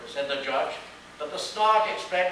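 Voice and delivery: ordinary delivery, nothing marked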